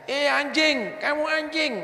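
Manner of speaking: high-pitched taunting insult; mocking insult. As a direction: fling it like a heckler cursing someone. Preacher acts out an insult. (hinaan mengejek dengan nada jauh lebih tinggi)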